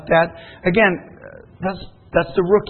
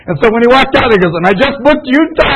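animated, louder retelling of the big news; excited; 6 semitones higher, 7 dB louder than usual. Retold or imitated. Excited retelling of on-stage announcement.

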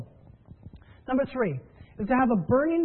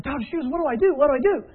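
higher, louder, imitating a frantic new recruit; mock panicked plea; 5 semitones higher, 5 dB louder, faster than usual. New associate calls his mentor after a police voicemail, mock panic. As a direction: play the flustered associate begging for help. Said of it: "he calls me freaking out".

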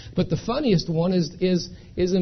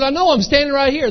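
raised, higher insistence; indignant protest; 8 semitones higher, 8 dB louder than usual. Retold or imitated. Brother-in-law insisting to hospital staff he's standing right here, loud and indignant. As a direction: push back loud and high, baffled.

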